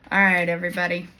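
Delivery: usual speaking voice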